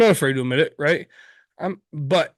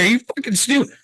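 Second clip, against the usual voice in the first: higher and a bit louder, mock disbelief; incredulous retold outburst; 8 semitones higher, 4 dB louder than usual. Retelling what she told the late gun owner, raised mock disbelief. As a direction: fire it back at him like he's an idiot. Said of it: "I was like".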